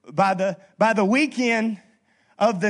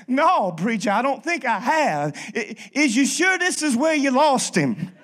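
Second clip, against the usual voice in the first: higher, louder Cajun punchline with a laugh; attempted Cajun accent; 5 semitones higher, 4 dB louder than usual. Boudreaux answering the preacher in a Cajun accent, laughing. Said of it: "my attempt at the accent".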